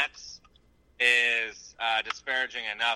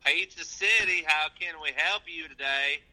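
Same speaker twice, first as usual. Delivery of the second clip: Southern pizza delivery voice; 3 semitones higher than usual. Pretend. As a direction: play a hokey Southern pizza clerk, only slightly. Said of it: "It's my pizza delivery voice".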